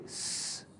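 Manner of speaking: "s" held as a long voiceless hiss; extended s. Shown as a demonstration. Teacher setting an exercise, "s" drawn out long. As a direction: stretch "s" into a steady hissing sound. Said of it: "can you make an extended".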